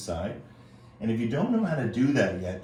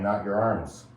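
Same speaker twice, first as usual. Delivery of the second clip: usual speaking voice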